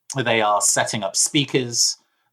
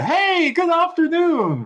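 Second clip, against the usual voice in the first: high-pitched, drawn-out chirpy hello; big bright canned cheer, pitched way up; really cheerful greeting; 3 dB louder than usual. Acted. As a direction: greet like an over-cheerful customer service android. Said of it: "really cheerfully".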